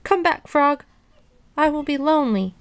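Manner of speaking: high-pitched lonely pleading; forlorn plea. A narrator's reading. Toad alone in the dark begs Frog, forlorn plea. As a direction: plead high for your friend's return.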